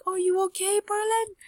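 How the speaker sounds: high-pitched worried question; concerned girl. Acted. Vaxia checks on fainted Perlin.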